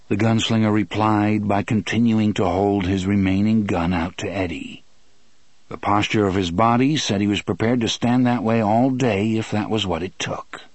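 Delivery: ordinary delivery, nothing marked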